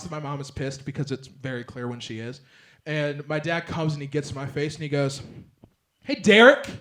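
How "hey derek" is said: stern callout. Retold or imitated. Dad gets in his face.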